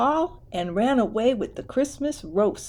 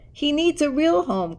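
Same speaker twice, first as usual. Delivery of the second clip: crying out; 7 semitones higher than usual. A narrator's reading. Girl begging her family to keep him, crying out. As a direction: cry it out, pleading for the crocodile. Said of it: "cried Alice Jane".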